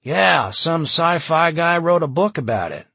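loud, high, building excitement; excited bystander. A narrator's reading. Bystander tells the crowd Jim's right, excitedly.

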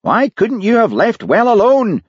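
exasperated high-pitched outburst; shouted. A narrator's reading. Daviot rebukes Hamish for not leaving well alone, high shout. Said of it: "shouted Daviot".

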